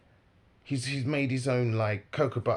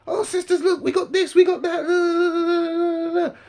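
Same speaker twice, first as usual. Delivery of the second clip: very high, loud mock hawker; mocking sales patter; 11 dB louder, slower than usual. Main guy calls over two sisters, high mocking patter. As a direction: squeal it out, trailing into a long drone. Mimicking man pitching to passing women.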